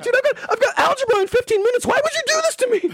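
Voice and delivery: very high squealing panic; panicked friend. Retold or imitated. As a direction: squeak it out like a terrified kid. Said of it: "And he's like".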